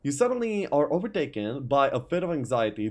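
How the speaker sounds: ordinary talk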